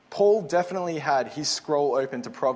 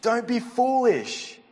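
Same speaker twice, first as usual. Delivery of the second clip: a father scolding his foolish son; stern father warning; 6 semitones higher than usual. Retold or imitated. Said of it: "He's actually saying".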